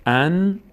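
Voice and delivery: teacher demonstrating a vowelless ن in "ان"; vowelless consonant. Shown as a demonstration. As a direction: sustain the closing ن of "ان".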